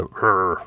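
a wolf's throaty rrr; wolf growl. Acted. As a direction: growl it out like an animal. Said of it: "Ulven morrade".

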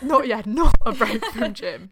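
loud laughing, slowed; laughing loudly. Real, not acted. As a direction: belt it through laughter.

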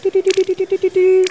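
high mechanical scanning sound; scanning beeps. Acted. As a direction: beep like a scanner. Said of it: "Searching fair gold".

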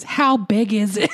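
bratty grumbled question, laugh breaking in; grumpy mock-brat voice on the edge of laughter. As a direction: whine it like a hungry, grumpy brat. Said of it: "in a bratty, grumpy voice".

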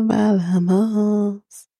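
"Balamos" drawn out very slowly; "Balamos" alargado y lento; slow Spanish word. Shown as a demonstration. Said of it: "in English, it means".

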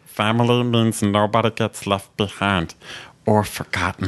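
lightly voiced alien creature; a hint of the little alien; Stitch voice. Host shows cohosts his old Stitch voice. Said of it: "I used to do Stitch".

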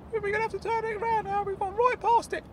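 high, fast fussy character voice; high fussy old uncle voice; high-pitched Claude. Acted. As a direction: rattle it off in a high squeaky voice. Claude insisting on turning.

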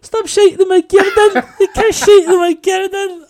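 very high, shouted Scottish impression; Scottish accent. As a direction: yell it as an outraged Scottish woman, stretching garden. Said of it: "I was just going round going".

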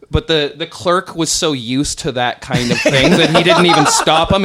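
normal talking voice

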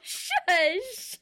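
high, loud mock scolding; mock-outraged squeal; playful shushing. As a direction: shush a teasing friend in protest. Playfully silencing co-host again.

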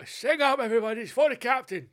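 high, loud rallying call; hammy showman. Pretend. [name] urging the audience to sing, high and loud. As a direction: rouse the crowd like a showman.

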